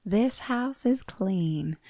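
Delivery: soft, slow, drawn-out pronouncement; quiet, lingering film quote; quoting iconic line. Retold or imitated. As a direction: deliver a famous film line, hushed and slow. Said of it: "this iconic line".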